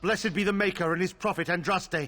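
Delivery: defiant shout. Acted. Prayer shouted at demon.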